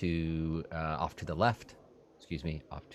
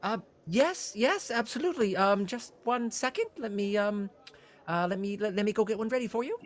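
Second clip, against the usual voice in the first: high, eager innkeeper voice; fussy innkeeper; 13 semitones higher than usual. Acted. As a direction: play a flustered, helpful innkeeper pitched high.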